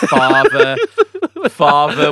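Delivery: booming theatrical call of Father; hammy stage actor. Retold or imitated. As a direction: bellow Father like a ham actor. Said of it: "I always quote that".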